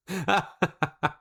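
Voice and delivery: high burst of laughter; amused high cackle. Real, not acted. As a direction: crack up laughing. Amused by the comment.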